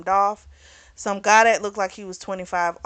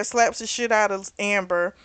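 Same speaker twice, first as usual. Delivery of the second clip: normal talking voice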